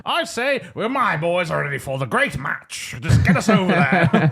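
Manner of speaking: high, silly British officer; Montgomery caricature. Acted. Montgomery eager for the great match, cartoonish high voice. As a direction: ham it up as a cartoon Brit, pitched high. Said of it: "how cartoonish he does get".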